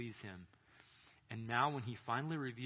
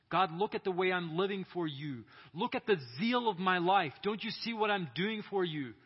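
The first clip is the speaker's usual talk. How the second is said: higher, louder appeal to God; impassioned plea; 6 semitones higher, 6 dB louder than usual. Acted. Saul begs God to step into the story, impassioned plea. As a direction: plead upward with mounting urgency.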